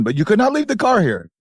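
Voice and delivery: put-on high, insistent Indian-accented hotel owner; hammed-up Indian hotelier, pitched high; mock Indian accent. Hotel owner refusing to keep the stranded car, mock Indian accent. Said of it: "He kept saying that".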